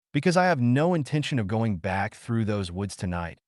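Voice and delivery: plain reading voice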